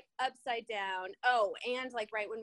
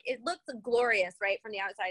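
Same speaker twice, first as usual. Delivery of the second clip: usual speaking voice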